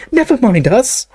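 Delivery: put-on high, urgent girl; young girl voice. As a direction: wave it off, urgently. Hermione urges, voiced higher.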